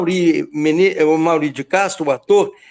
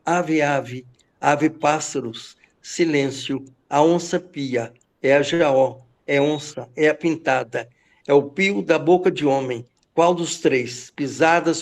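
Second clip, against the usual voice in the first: lower, hushed incantation of the poem; hushed poem recitation; 4 semitones lower than usual. Acted. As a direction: draw listeners in, lower and hushed. Recites opening of poem again. (declamação mais grave e contida)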